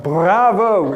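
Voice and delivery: loud, high "bravo" cheer; mock French cheer. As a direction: cry it out like a theatre audience. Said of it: "I'd be a little bit French".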